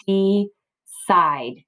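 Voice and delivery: "decide" as "dee", pause, "side"; "decide" sounded out in two separate pieces; split into syllables. Shown as a demonstration. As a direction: break "decide" in two with a long e. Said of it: "it's gonna say the long sound".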